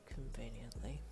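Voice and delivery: low, soft and cool; coolly. A narrator's reading. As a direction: say it dry and cool. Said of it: "Aizawa replied coolly".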